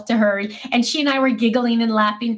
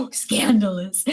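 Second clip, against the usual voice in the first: mock shocked gasp; 3 semitones lower, slower than usual. Real, not acted. Reacting to married her boss.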